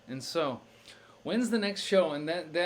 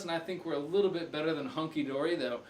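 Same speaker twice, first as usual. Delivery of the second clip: normal talking voice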